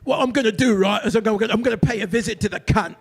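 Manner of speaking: imitated Cockney tough guy; Cockney accent. Overheard London guy on the phone, mock Cockney. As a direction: put on a thick London Cockney. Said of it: "like a fucking cockney-ass".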